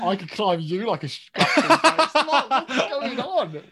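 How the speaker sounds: laughing loudly and stretched, high; breaking up in disbelief. Real, not acted. Laughing at awkward scene.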